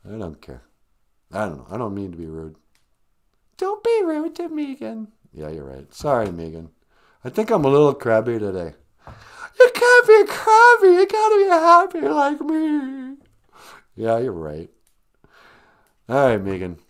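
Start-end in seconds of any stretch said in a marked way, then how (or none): 3.6-5.1 very high little character voice; scolding sidekick. Acted. 9.6-13.2 very high, loud character squeal; sing-song it in a piercing cheery puppet voice; relentlessly happy sidekick. Acted. Sidekick character cheers up crabby host.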